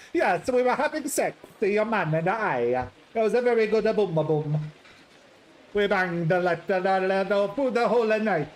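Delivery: put-on loud, cheeky Italian accent. Patrizia bragging to the visitor about her nights with Ulysses, cheeky Italian accent. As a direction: brag shamelessly in a big Italian voice.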